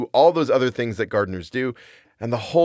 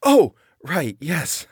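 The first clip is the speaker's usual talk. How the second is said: stammering; 5 semitones higher, much slower than usual. Acted. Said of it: "I stammered".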